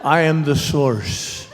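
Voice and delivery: slow, lightly acted self-important father; understated pompous pronouncement, measured and slow; mock great modesty. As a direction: imitate Dad's grand self-regard, drawing it out. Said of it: "in his great modesty".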